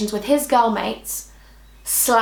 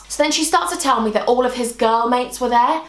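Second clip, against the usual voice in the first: usual speaking voice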